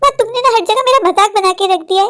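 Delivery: ordinary delivery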